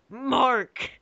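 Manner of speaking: high, drawn-out call of the name; hammed-up wail of a name; mock cry. Name cried out after quiet take.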